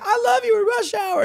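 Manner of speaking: very high, starstruck fan blurt; fanboy gush. Retold or imitated. Dancer gushing to Chris Tucker, very high starstruck squeal. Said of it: "I was like".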